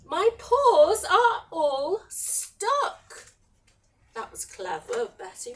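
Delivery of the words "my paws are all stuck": high, slow wail of complaint; building to a loud, drawn-out grievance; outraged dragon. Acted. Dragon stuck in the gloop, wailing.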